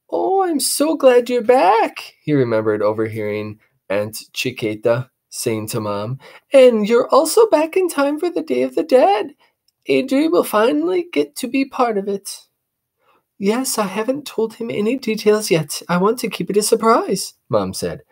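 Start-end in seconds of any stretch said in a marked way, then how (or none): 0.1-12.5 high, delighted aunt; excited aunt greeting her sister; aunt's voice. Acted. 13.4-17.3 high, secretive mother; mother plotting a surprise; mom's voice. Acted. Mom replies to aunt.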